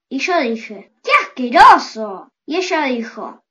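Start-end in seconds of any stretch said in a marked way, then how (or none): 1.0-2.3 disgusted. A narrator's reading. Girl disgusted by snails.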